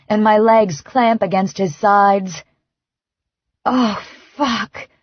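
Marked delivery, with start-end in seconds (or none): 3.6-4.9 fading breathy curse; breathed. A narrator's reading. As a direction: let the curse fall away on a breath. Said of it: "I breathe".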